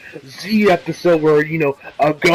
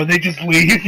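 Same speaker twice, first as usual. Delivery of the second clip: ordinary delivery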